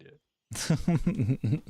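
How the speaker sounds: loud and slow, stretching it out; booming slow emphasis; dramatic loud slowdown. Acted. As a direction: drag it out big like a movie trailer. Friend hams up the chase threat.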